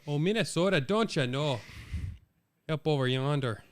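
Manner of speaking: hammed-up Minnesotan; Minnesota accent. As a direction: exaggerate the folksy Minnesota vowels. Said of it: "Minnesota's got a weird accent".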